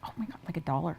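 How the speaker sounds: sheepish whisper, barely voiced. Real, not acted. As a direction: confess the price in a hushed whisper. Admitting the tiny price.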